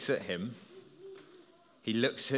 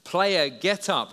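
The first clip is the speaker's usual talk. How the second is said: slow, louder command, higher; commanding call; 5 semitones higher, 6 dB louder, much slower than usual. Pretend. Preacher to the congregation, pretending to command an injured player, slow and louder. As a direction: call the player up slowly and firmly. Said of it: "if I said to that player".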